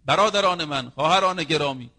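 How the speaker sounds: normal talking voice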